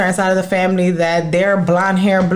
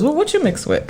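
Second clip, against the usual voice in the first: high put-on questioning voice; mock-curious, high; imitated nosy guy; 9 semitones higher than usual. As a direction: mimic a prying stranger, pitched up. Mimicking guys' first question.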